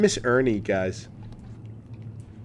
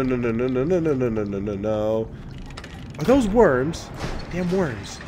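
ordinary talk

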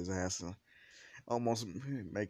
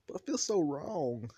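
high, louder whine; whining protest; 8 semitones higher, 5 dB louder than usual. Acted. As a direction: whine it like a reluctant son. Son whines about wearing socks.

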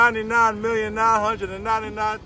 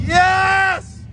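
shouting; 9 semitones higher, 4 dB louder than usual. Real, not acted. Coach cheering on push-ups.

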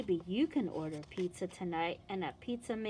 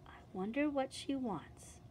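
usual speaking voice

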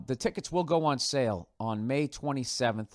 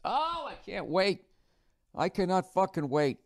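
eager excitement; 8 semitones higher than usual. Real, not acted. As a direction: gush with anticipation, voice lifted high. Eager about the coming tour.

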